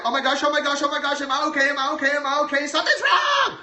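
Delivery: imitating a baby in panic, building to a high shout; panicked baby impersonation. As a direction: play a frantic newborn who thinks something is wrong. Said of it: "That's my baby impersonation".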